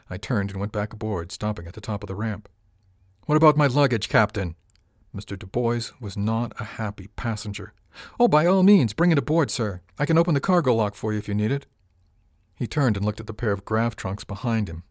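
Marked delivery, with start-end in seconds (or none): 3.3-4.6 calling out. Acted. Dubois calls after captain. 8.2-11.7 mock politeness. Captain mock-obliging to rude passenger.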